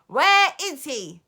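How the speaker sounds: loud, slow sharp demand; impatient witch snap; snapping. Acted. Grand High Witch snapping at the audience, loud. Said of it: "snapped the Grand High Witch".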